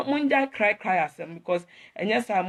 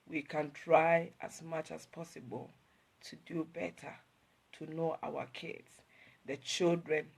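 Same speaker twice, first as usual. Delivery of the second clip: ordinary talk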